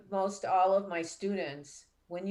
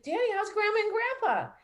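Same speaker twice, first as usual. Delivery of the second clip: eager question; 13 semitones higher, 3 dB louder, faster than usual. Retold or imitated. Asking late father about grandparents.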